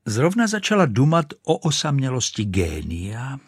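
ordinary delivery, nothing marked

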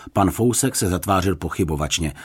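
usual speaking voice

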